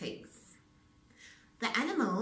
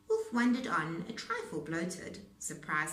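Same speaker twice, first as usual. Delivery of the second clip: usual speaking voice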